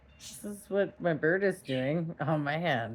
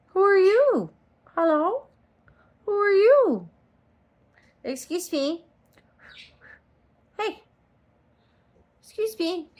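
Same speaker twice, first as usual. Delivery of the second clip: high, loud sing-song pet talk; 13 semitones higher, 7 dB louder than usual. Real, not acted. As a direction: coo at the bird, high and bright. Baby talk to bird.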